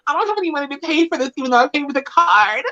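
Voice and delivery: high, fast, flirty coo to the pizza guy. Pretend. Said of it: "be like".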